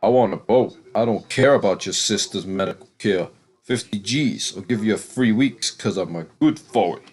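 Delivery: lightly put-on voice of a grubby bent cop; a hint of a greedy crooked cop; scruffy cop impression. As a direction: sneer it out like a scruffy dirty cop. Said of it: "He's like".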